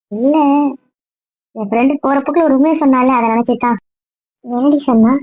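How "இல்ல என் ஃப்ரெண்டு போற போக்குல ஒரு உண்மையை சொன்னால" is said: usual speaking voice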